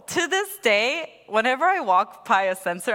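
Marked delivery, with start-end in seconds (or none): none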